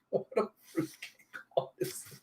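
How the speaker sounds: breathless hushed disbelief; exasperated whisper. Real, not acted. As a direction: mutter it under your breath. Reader's exasperated aside.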